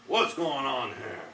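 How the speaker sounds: loud, slow rumbling demand; waking giant. Acted. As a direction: roar awake, slow and heavy. Giant wakes suddenly.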